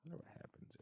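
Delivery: breathy whisper of curiosity; whispered aside. Real, not acted. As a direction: whisper it to yourself, scheming. Curious aside before mishap.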